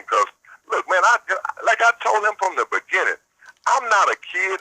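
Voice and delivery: normal talking voice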